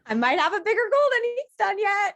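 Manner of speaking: high, loud, laughing through the words; laughing realization. Real, not acted. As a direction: laugh as you realize it, voice shooting up. Laughing at her own outsized goal.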